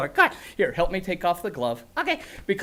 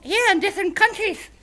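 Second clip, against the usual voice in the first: chirpy little dragon saying hi; silly puppet creature; 11 semitones higher than usual. Acted. Grork puppet speaking.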